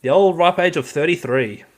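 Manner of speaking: loud cheering yay; excited cheer. Real, not acted. Cheering the birthday.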